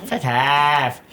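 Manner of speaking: slow, drawn-out mock British dismissal; hammed-up British imitation; bad British accent. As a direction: stretch it out in a badly faked British accent. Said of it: "I can't do a British accent".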